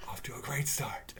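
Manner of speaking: whispered dry aside; sarcastic whisper. Real, not acted. Reviewer on the show contradicting itself, sarcastic whisper.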